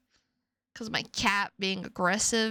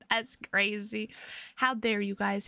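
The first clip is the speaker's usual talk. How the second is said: ordinary talk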